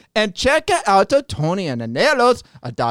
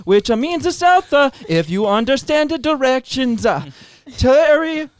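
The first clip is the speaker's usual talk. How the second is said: put-on Italian accent; 3 semitones higher than usual. Guest reading the sponsor ad in a put-on Italian accent. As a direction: ham up an Italian ad read. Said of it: "Read it in your Italian accent".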